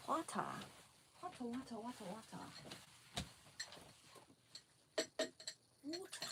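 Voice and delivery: playful whispered water. Pretend. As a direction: toy with the word softly. Playing with word water.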